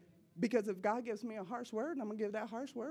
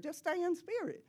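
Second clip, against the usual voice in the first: insistent instruction; 9 semitones higher, 6 dB louder than usual. Retold or imitated. Voicing God's exasperated instruction.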